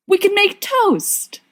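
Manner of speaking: high, slow, eager; eager coaxing. Acted. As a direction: sell the toast idea. Lily coaxing brightly.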